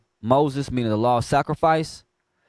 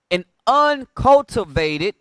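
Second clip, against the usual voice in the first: emphatic preaching; 6 semitones higher, 5 dB louder, slower than usual. Acted. Emphatic preaching of quoted word.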